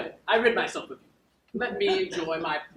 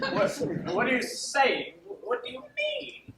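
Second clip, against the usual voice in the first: baffled; 9 semitones higher than usual. Acted. Malvolio baffled.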